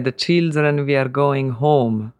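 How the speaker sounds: put-on slow, deliberate Hungarian accent; strong Hungarian accent. Father announcing the move to his children, strong Hungarian accent. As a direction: imitate a Hungarian father, stressing first syllables. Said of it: "in his very strong Hungarian accent".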